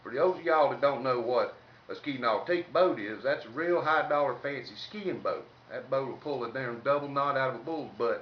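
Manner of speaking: normal talking voice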